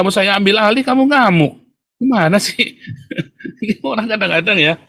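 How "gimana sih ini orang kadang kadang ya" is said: laughing. Real, not acted. Laughs at the absurdity.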